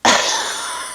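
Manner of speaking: a long, soft, put-upon sigh; weary sigh. Retold or imitated. King answers queen with a soft, weary sigh. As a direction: sigh before answering the bride. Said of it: "the king's like".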